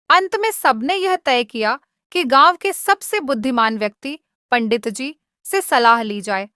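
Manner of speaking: ordinary delivery, nothing marked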